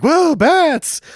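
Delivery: high, loud surprised yelp; mock startled cry. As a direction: squeal it as if bats burst out. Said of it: "Janine Turner's like".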